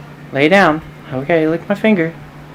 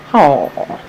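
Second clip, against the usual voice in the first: ordinary talk, nothing marked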